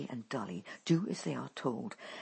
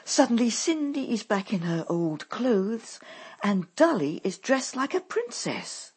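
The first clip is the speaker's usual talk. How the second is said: usual speaking voice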